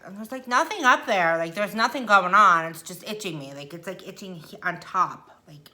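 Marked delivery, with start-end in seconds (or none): 0.0-2.6 loud, frustrated rant about the itch; loud complaint. Real, not acted. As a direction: vent loudly about an itch. Complaining about itchy nose.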